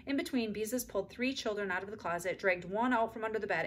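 ordinary delivery, nothing marked

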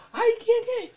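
hammed-up frightened falsetto; mock startled lady. Lady hears a voice from heaven, comic high-pitched fright. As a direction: squeal it very high, pretending to be startled. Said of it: "la señora se asustó".

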